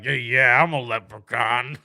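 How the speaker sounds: put-on lazy drunk guy claiming; drunk man voice. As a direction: slur it like a tipsy bus rider.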